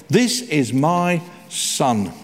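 This is my Son drawn out very slowly; slow solemn quote. Acted. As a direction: savour each word of This is my Son. Repeats God's words.

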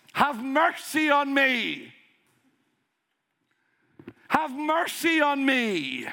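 high, drawn-out pleading cry. Retold or imitated. Blind man begging Jesus for mercy, crying out. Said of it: "crying out at the top of his voice".

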